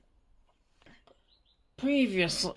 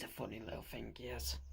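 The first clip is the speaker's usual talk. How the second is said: fond whispered aside; 5 semitones lower, 14 dB quieter, faster than usual. Real, not acted. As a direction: hush it, amused and fond. Fond aside about the cat.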